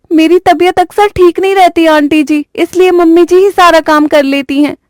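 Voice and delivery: high, meek excuse-making bride; explaining away her laziness to the aunt. Acted. (ऊँची आवाज़ में बहाना बनाती बहू)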